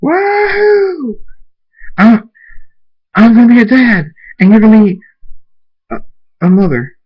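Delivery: high, loud whooping yell; overjoyed shout to his mate; joyful scream. Acted. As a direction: scream it with pure delight. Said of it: "Soren screams".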